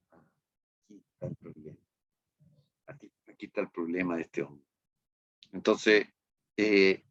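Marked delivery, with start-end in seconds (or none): 0.1-4.7 hushed emphasis. Real, not acted. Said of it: "dramatic drop".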